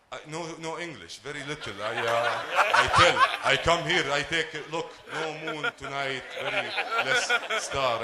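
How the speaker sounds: lightly put-on foreign accent, broken English; fake broken English. A hint of a foreign accent, faking he speaks no English. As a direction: play dumb in a thick non-native accent. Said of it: "And now you switch".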